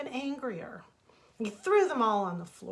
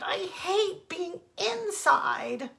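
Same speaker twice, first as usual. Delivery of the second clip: much higher, slow, louder whining; high whiny wail; 10 semitones higher, 5 dB louder, much slower than usual. Acted. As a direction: wail it out like a tantrum. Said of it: "he cried and said".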